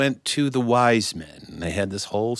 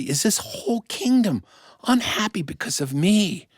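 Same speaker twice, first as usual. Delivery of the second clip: high, hurried fretting; a touch of panic over his people; worried king; 12 semitones higher than usual. Acted.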